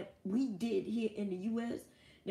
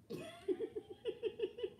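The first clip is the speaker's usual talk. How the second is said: soft, high squeaky laugh; quiet high titter; high giggle; 9 dB quieter than usual. Real, not acted. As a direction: giggle at his own joke. Laughing at himself.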